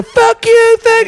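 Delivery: high, loud mocking shriek; woman yelling. Woman outside a club screaming at a cop, high and loud. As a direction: screech it like an angry woman, imitating. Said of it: "a woman can come out and go".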